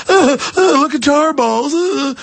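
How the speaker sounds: high, hammed-up gasping alarm; mock panicky doomsayer. Host mocking doomsayers over tar balls, high hammed-up panic. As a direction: squeak it out like a fake panicked alarmist.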